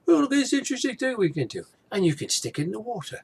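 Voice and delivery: hasty high put-on British accent; a quick, lightly mocking British voice; fast, high imitation of a British vlogger. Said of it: "if he's Scottish or he's English".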